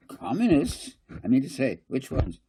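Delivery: high, loud alarmed outburst; a councillor shocked by communists. A narrator's reading. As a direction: react as if the reds are coming. Kading alarmed by commies.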